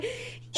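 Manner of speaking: unvoiced high squeak; boyfriend's squeal while hugging. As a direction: squeeze out a tiny squeal.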